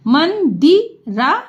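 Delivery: Mandira spread out syllable by syllable; slow sounding out. Shown as a demonstration. Teacher to pupils, spelling Mandira aloud slowly.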